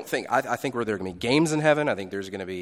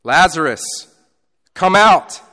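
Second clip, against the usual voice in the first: loud cry; 7 semitones higher, 15 dB louder than usual. Retold or imitated. Preacher voicing Jesus shouting to Lazarus. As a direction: shout it out, much louder and higher. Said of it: "He cries out with a loud voice".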